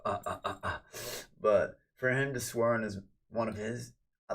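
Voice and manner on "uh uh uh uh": quick voiceless ape hoots; monkey grunts. Acted. Streamer greeting a viewer, monkey grunts. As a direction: huff in short bursts like a monkey. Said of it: "be a gorilla in a monkey world".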